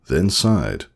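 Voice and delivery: usual speaking voice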